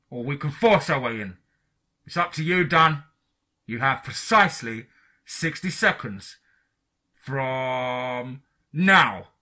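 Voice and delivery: restrained shouted warning; agent shouting. A narrator's reading. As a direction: issue the deadline with measured menace, stretching the end. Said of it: "The agent outside began shouting".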